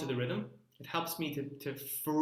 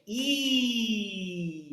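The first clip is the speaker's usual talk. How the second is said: an ET-like ee; alien ee; 3 semitones higher than usual. Shown as a demonstration. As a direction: squeak it like an alien. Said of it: "like an Alien or E T".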